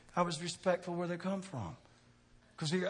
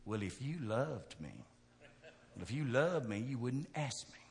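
smart aleck remark; 4 semitones lower, 5 dB quieter than usual. Retold or imitated. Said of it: "one of the most smart aleck ones".

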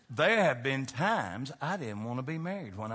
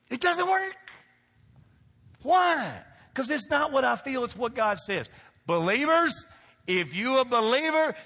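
higher and louder, calling out to the room; full-force preaching; 8 semitones higher, 5 dB louder than usual. Acted. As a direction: preach it hard with call and response.

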